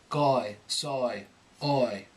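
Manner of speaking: darker, raucous Cockney oi. Shown as a demonstration. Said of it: "we think of it more like oi, darker, more raucous".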